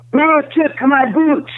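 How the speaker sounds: high, slow, hammed-up starlet wail; mock movie line. Retelling a movie scene, high hammed-up starlet wail. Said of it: "she comes down and she says".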